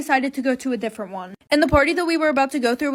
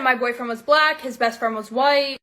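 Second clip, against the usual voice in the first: plain reading voice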